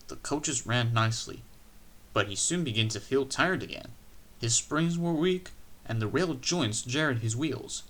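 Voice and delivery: ordinary delivery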